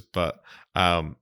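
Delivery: normal talking voice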